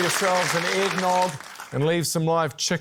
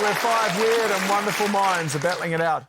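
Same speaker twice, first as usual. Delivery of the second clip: announcer voice; 4 semitones higher than usual. Acted. Announcer opens segment.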